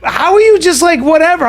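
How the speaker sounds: mock her baffled high-pitched question; imitating sister. Sister asking how he shrugs it off, high and incredulous. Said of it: "she was like".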